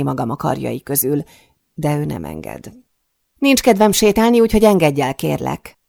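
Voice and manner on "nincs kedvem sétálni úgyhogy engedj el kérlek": high, loud refusal easing on the last word; firm plea. A narrator's reading. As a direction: insist urgently, then soften into please. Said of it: "szólok rá erélyesebben". (határozott, emelt hangú kérés)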